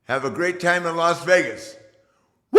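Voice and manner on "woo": sign-off wooing cry; Ric Flair woo. Real, not acted.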